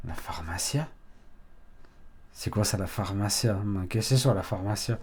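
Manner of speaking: low, soft puzzled questioning; imitated puzzled passer-by. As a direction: quietly voice a baffled listener. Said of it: "Elle, elle dit".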